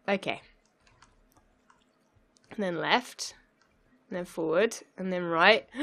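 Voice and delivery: normal talking voice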